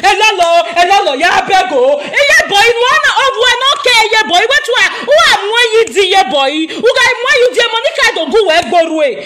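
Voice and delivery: sneering mock plea to Lolo; mock begging. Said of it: "did any of you hear Lolo begging".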